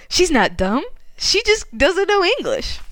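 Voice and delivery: imitated amazed revelation; mock astonished realization. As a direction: pitch leaping up in discovery. Jacob's acted sudden realization.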